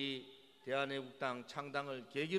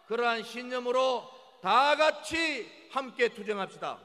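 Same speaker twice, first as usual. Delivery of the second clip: roaring rallying speech; 9 semitones higher, 12 dB louder than usual. Acted. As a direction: stretch the words to rouse the party. Rally speech at full force. (우렁찬 유세 연설)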